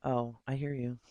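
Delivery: normal talking voice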